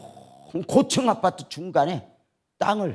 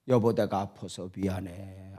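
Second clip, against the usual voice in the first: soft, lower, tender apology; sorrowful apology; 9 semitones lower, 7 dB quieter than usual. Retold or imitated. Sick wife apologizing to her husband, soft and sorrowful. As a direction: apologize gently, heart aching.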